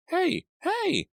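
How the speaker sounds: very high urgent cry; high alarmed cry of hey; cried out. A narrator's reading. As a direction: call out in alarm. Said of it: "Artie cried".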